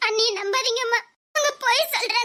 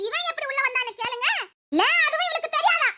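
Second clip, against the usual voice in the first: high-pitched, indignant question; scornful challenge; 5 semitones higher than usual. Acted. Accuser challenging the girl's story, scornful. Sneering challenge during family quarrel.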